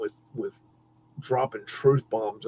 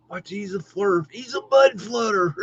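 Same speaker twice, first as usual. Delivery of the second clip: mocking taunt; 8 semitones higher than usual. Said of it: "when they go".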